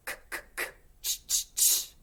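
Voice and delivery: crisp voiceless consonant bursts, K then ch; consonant drill. Shown as a demonstration. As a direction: spit out each consonant sharply for the echo. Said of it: "I'll go first. You be my echo".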